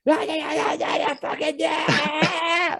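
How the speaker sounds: very high, slow drawn-out yelling; mock road rage yell. Imitating an angry driver yelling out the window, hammed up high yell. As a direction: ham up a driver screaming from the car window. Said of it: "they're like".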